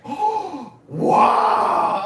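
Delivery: high, loud astonished oh wow; shocked gasp. Retold or imitated. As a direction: react in disbelief. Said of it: "I was like".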